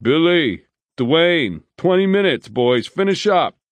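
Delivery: loud, high shout across the yard. A narrator's reading. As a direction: call the men in from outside. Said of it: "someone shouted".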